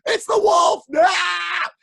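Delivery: very high, loud shriek; terrified shout. Acted. Pigs shrieking when they see the wolf's paw. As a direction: scream in panic.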